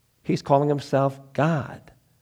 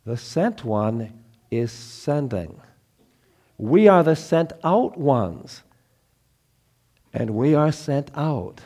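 slow weighty preaching; slower than usual. Acted. Slow weighty sermon lines.

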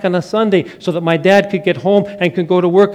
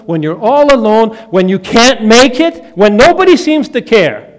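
rising, louder preacher's build of conviction; preaching at full force; 4 semitones higher, 5 dB louder than usual. Acted. Pastor assures the congregation God won't leave them, preaching with mounting force. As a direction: preach it, climbing with every line.

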